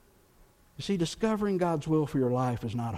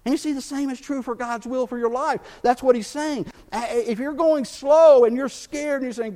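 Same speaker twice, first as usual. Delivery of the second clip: preaching; 8 semitones higher, 7 dB louder than usual. Acted. Preaching climax.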